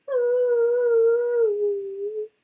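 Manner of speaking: high, loud, sobbing hoo; lonesome wail. Acted. A mouse wails alone in the night, high and sobbing. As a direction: let out a small lost wail. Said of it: "a sobbing, a lonesome wail".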